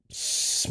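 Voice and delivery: breathy psst; whispered psst. Shown as a demonstration. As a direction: hiss a psst for attention. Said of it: "you say".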